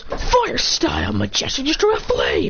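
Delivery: high, slow battle-move shout; hammed-up special-move call; attack call. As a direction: announce your fire move like an anime fighter. Calling out a fire attack.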